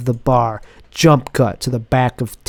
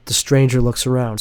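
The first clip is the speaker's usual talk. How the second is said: usual speaking voice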